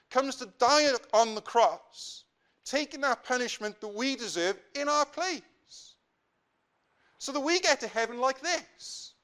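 ordinary talk

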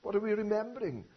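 ordinary talk

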